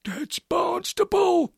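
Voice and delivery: usual speaking voice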